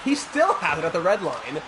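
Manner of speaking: ordinary talk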